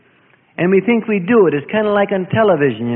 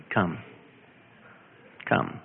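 quiet call; 14 semitones lower, 13 dB quieter than usual. Retold or imitated. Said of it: "He just says".

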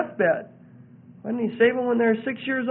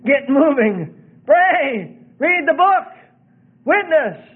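loud, rising exhortations in short bursts; rousing shouted call to action; urgent cry; 4 semitones higher, 5 dB louder, slower than usual. Acted. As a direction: cry out commands, building higher. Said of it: "almost like a cry of the Spirit".

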